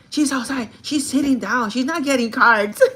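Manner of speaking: hammed-up urgent report; excited bagger tattling. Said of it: "This is the way he said it".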